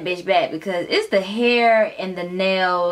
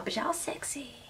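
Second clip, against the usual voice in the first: whispered aside; 4 semitones higher, 16 dB quieter than usual. Real, not acted. Teasing the couple, a whispered compliment. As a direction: breathe it out as a secret.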